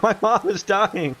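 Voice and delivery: high, slow mock-dramatic wail; panicking dog voice. Dogs crying out while their mother gives birth, high mock wail.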